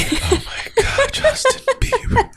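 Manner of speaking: very high, squeaky laughing words; helpless laughing. Real, not acted. Reacting to co-host's [name] joke, cracking up laughing. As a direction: squeeze the words out through laughter.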